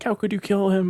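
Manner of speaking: high-pitched mock distress; imitated freak-out. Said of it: "freaking out".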